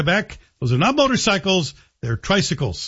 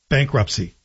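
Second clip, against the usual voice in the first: ordinary delivery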